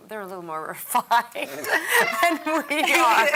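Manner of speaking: amused laughing. Real, not acted. Actor teasing castmates about their characters, laughing. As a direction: laugh through a slow, loud tease.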